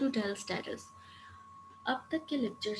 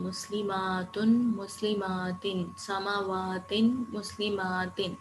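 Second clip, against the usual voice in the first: slow drill; much slower than usual. Shown as a demonstration. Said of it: "teacher drills word endings".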